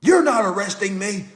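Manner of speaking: high, mock-indignant protest; imitated entitled defiance. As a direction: play someone indignantly refusing to be handled. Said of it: "They be like".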